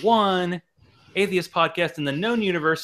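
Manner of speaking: loud, high announcer intro with drawn-out words; show announcer. Pretend. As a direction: belt out the show opening, stretching it slowly. Podcast host opens the show.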